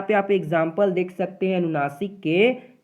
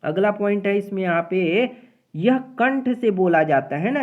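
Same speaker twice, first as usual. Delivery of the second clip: ordinary delivery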